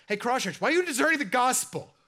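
loud, high indignant rebuke; mock guest preacher rebuke. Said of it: "one of the first things he said was like".

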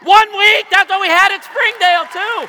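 high, loud, amazed call to the crowd; excited shout. Real, not acted. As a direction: holler it out with pitch way up. Amazed announcement of one-week marriage to crowd.

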